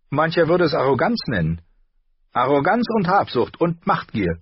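lightly voiced lady scolding the dwarf; a hint of an angry noblewoman. A narrator's reading. Catelyn voiced, annoyed. (leicht angedeutete empörte Adelige)

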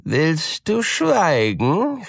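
slow, menacing cat voice; angry cat. A narrator's reading. Cat silencing the mouse, slow and menacing. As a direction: cut the mouse off, threatening. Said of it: "rief die Katze".

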